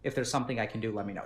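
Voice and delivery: soft, gentle sympathy; sympathetic. Shown as a demonstration. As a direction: softly console her. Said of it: "You can say".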